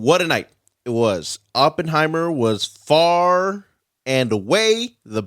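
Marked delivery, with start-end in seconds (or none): 2.7-5.2 "far" stretched long and raised, then "and away" slowly; announcer-like, dragging out "far and away"; drawn-out FAR AND AWAY. Real, not acted. Host reading the Oscars recap to co-hosts, drawn-out emphasis on "far and away". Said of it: "why you say it like that".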